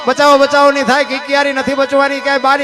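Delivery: high, loud rhythmic declaiming of a folk ballad; ringing bardic recitation, building drama; folk poem recital. Acted. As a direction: chant the earthquake verses like a bard rousing the crowd.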